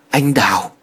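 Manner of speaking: delirious faint call. A narrator's reading. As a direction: call a name as if from a fever dream. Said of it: "Tiếng phát ra từ trong miệng của Thiện tuy nhỏ".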